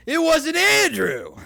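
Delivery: high, slow, loud shriek of a yell; boss yelling. Retold or imitated. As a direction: scream it out like a furious boss. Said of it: "And he's like".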